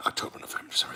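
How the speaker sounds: whispered counting; whispering. Real, not acted. Counting months under breath.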